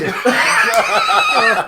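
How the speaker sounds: shrieking burst of laughter. Real, not acted. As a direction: howl with laughter. Cracking up at the joke.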